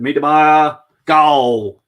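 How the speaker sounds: loud, high goal celebration; excited goal shout. Real, not acted. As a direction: shout the scorer's name and goal. Celebrating a Liverpool goal live.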